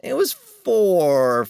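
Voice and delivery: "It was four" stretched slowly, "was" leaned on; drawn-out emphasis. Shown as a demonstration. Co-host defending his count, slow emphatic insistence. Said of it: "That was more than three".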